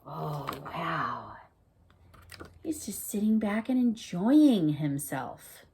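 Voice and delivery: soft hushed whisper; gentle storytime whisper. Acted. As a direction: whisper so as not to disturb him. Teacher whispers about peaceful character.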